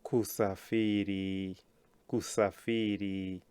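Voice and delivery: "Kusafiri" drawn out slowly, syllable by syllable; careful pronunciation demo. Shown as a demonstration. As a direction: say "Kusafiri" slowly for learners, long stressed "fi". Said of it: "Remember, not safari, it's safiri".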